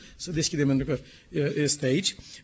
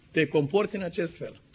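normal talking voice

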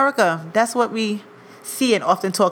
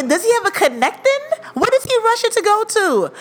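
high-pitched baffled questioning; retold indignant disbelief; incredulous; 11 semitones higher than usual. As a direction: question it like it makes no sense. Mock indignation at rushing passenger.

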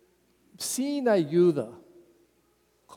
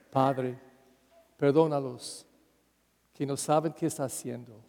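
Jesus forgiving; 5 semitones lower, 6 dB quieter than usual. Acted.

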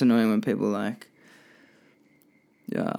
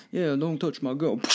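1 tough gangster line; 5 semitones higher than usual. Retold or imitated. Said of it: "quoting The Irishman".

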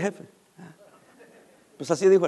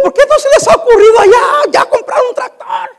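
incredulous outburst; 13 semitones higher, 6 dB louder than usual. Retold or imitated. Mocking why no tractor bought.

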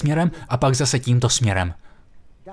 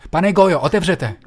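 higher, louder urgent call; calling through the door; 5 semitones higher, 4 dB louder than usual. Retold or imitated. Neighbour at the door calling for Mr Gojo, urgent shout.

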